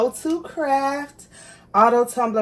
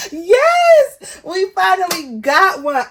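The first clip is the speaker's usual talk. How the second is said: much higher, louder, joy close to crying; tearful joy; 9 semitones higher, 7 dB louder, slower than usual. Real, not acted. Celebrating to viewers after long failures, tearful joyful cry. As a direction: cry out in relief and delight. Overjoyed by the result.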